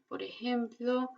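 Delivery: normal talking voice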